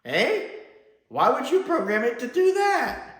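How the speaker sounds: high, loud incredulous questioning; baffled disbelief. Retold or imitated. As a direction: question it in exaggerated bewilderment. Acts out his baffled reaction.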